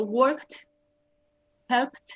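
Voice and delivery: "worked, helped" each ending in a clear released T. Shown as a demonstration. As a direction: model "worked, helped" slowly with T at the end. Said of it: "termina como una T al final".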